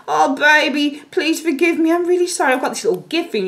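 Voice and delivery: high, sugary pleading; imitating a seducer begging with a gift; seductive apologizer. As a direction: sweet-talk them into forgiving you. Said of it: "he'll move very much slowly into you".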